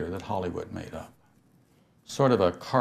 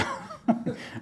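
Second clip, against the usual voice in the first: high airy laugh; 6 dB quieter than usual. Real, not acted. Laugh after the shout.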